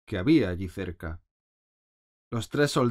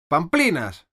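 loud, higher, firm retort; raised voice; 7 semitones higher, 7 dB louder, slower than usual. A narrator's reading. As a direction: cut her off by raising your voice. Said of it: "alzando mucho la voz". (Alicia alza mucho la voz, firme)

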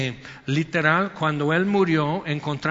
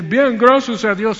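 chirpy higher, louder fake "gracias a Dios"; mock pious answer; 6 semitones higher, 8 dB louder than usual. Preacher mocking a fake cheerful church answer. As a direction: parrot the hollow churchy reply.

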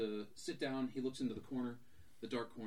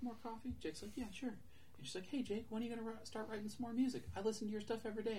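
higher, lightly voiced friendly waitress; 9 semitones higher, 4 dB quieter, faster than usual. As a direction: play a chirpy diner waitress, higher. Diner waitress and Jake voiced.

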